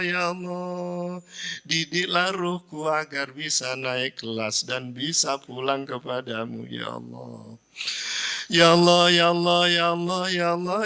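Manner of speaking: rhythmic repeated invocation, closing softly; dhikr prayer. Shown as a demonstration. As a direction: pray it, waves rising then falling. Dhikr and supplication. (zikir dan doa khusyuk)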